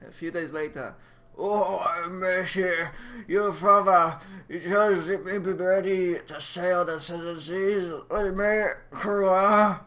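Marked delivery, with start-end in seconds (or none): 1.4-9.8 gruff pirate voice. Acted. Pirate-like character speaking.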